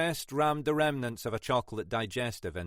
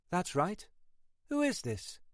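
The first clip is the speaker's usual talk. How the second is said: lightly voiced young woman, higher and softer; wary woman on phone; 8 semitones higher, 4 dB quieter than usual. Annabel picks up a stranger's call at night, lightly voiced and wary. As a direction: answer a late call, guarded.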